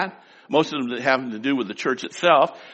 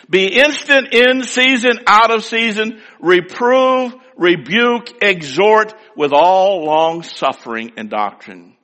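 higher, louder preaching of the verse; 5 semitones higher, 4 dB louder, slower than usual. Acted. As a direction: hammer each command home. Preached scripture at full force.

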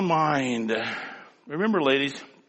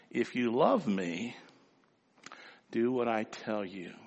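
mother's soft saying; 5 semitones lower, 9 dB quieter than usual. Retold or imitated. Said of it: "my mother said that".

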